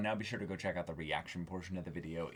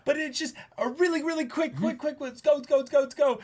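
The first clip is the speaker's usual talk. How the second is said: quick excited urging, pitched way up; hurried rush; 9 dB louder than usual. Retold or imitated. Host mimicking rushed band members, high and urgent. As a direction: hurry them along, high and bright.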